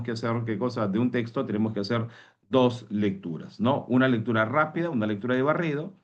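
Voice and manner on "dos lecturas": "dos" drawn out, very slow and weighty; teacher hammering the point: "dos lecturas" slowed right down; stress on dos. Shown as a demonstration. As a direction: lean on "dos lecturas", slowly. Teacher stressing two readings.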